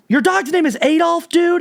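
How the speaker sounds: can't believe what he's hearing; incredulous friend. Retold or imitated. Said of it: "And then he's like".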